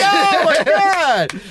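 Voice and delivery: high, slow laughing oh my God; laughing disbelief. Real, not acted. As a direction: stretch it out while cracking up. Reacting to winning guess.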